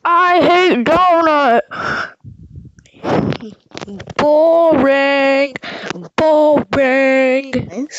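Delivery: slow, dragged-out heckle; drawn-out jeering. Acted. Fan booing Donut, drawn-out jeer. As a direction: stretch each word out in disgust.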